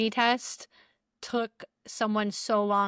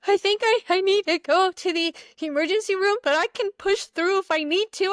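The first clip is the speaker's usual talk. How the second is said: imitating friend in pain; 10 semitones higher, 4 dB louder than usual. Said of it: "She's like".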